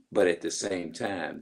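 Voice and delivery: normal talking voice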